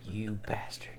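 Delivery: hushed playful curse; whispered insult. Real, not acted. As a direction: whisper it, mock betrayed. Mock insult after refusal.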